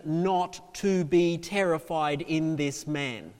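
slow, weighty pulpit build; dramatic preaching. Acted. Preacher describing the man on the water, dramatic preaching. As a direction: spell out the awe phrase by phrase.

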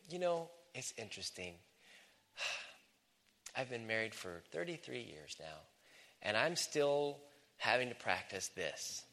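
soft, unhurried confession with a sigh; gentle older-woman confiding. Acted. Older woman admitting to younger lady, softly confiding. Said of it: "have a conversation with a younger lady".

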